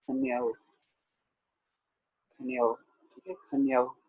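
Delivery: खन्याओ as khan-yaa-o, long aa before o; correct pronunciation. Shown as a demonstration. Teacher modelling for students, खन्याओ with full aa then o. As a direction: open out the aa in खन्याओ. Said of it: "उच्चारणता की जाएगी".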